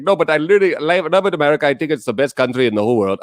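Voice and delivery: put-on Indian accent. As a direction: imitate an Indian English speaker. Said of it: "okay, an Indian person".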